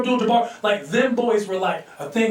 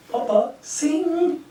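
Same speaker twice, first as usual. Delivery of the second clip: coaxing father; 6 semitones higher, much slower than usual. Retold or imitated. Urging father at party.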